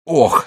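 pained grunt. Acted. Wounded shoulder pain.

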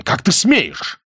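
high-pitched angry outburst; outraged cry. A narrator's reading. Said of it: "кричал он". (возмущённый крик)

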